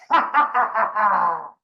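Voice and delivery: loud, high, menacing fake laugh; hard staccato "ha" laugh, acted; forced cynical laugh. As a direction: laugh like you want to kill them. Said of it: "act like you wanna kill them".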